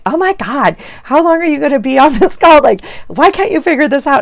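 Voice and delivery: high, mock-exasperated teen whine; exasperated daughter. Daughter calling in over her mom's long phone call, high and exasperated. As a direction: play the fed-up daughter calling from the next room. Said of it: "she's like".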